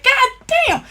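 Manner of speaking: high mock exasperation; exasperated squeal. Real, not acted. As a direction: squeak it out in disbelief. Reacts to full price again.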